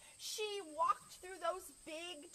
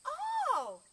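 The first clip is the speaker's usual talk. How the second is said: high, loud, delighted oh; sudden realisation; 13 semitones higher, 9 dB louder than usual. Acted. Librarian finally understands Chicken, surprised realisation. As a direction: let the penny drop on a high oh. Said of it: "said the children's librarian".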